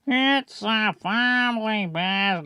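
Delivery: high, drawn-out character voice; rejected Adam voice. Shown as a demonstration. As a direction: pitch it up and stretch the vowels. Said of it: "he doesn't talk like that".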